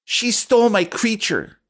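put-on high childish accusation, called out; a little girl tattling on the child next to her; quite loudly. Rosa tells her mom about the other girl, high child's call. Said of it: "says quite loudly".

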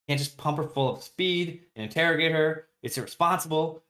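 a doctor scolding harder and harder, pitch climbing; angry doctor. Retold or imitated. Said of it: "very, very mad".

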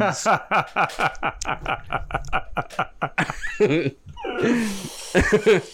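high, rapid-fire giggling fit; long cackling laugh. Real, not acted. Host cracking up at his own insult, long laugh. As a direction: laugh on and on in quick bursts.

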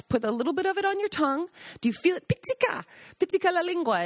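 high, coaxing Italian guide; Gaggi teaching. Retold or imitated. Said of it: "tell the guests".

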